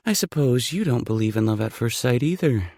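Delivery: flirty heroine, light and teasing; woman's voice. Acted. Female lead voiced by narrator.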